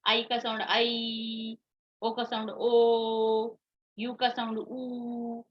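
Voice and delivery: slow teacher drill, stretching "आई", "ओ" and "ऊ" into long vowels; teacher modelling long vowels "आई", "ओ", "ऊ" at a slow pace; long vowel demo. Shown as a demonstration. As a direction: draw each long vowel out slowly for the class. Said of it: "अब मैं पढ़ाती हूँ आपको long sound".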